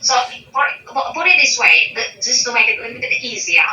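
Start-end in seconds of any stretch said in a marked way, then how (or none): none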